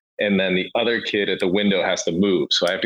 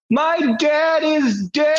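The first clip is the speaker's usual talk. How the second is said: put-on much higher, slow, shouted child wail; wailing kid; slower than usual. Passenger imitating the kid's shouted wail, high and slow.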